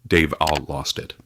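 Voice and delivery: ordinary delivery, nothing marked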